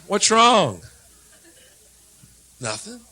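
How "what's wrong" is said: louder, higher nagging "What's wrong?"; loud mock-concerned "What's wrong?"; mock concerned stranger. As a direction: imitate a pushy stranger asking "What's wrong?". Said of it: "You're just standing somewhere".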